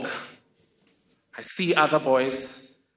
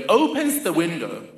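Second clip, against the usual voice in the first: ordinary delivery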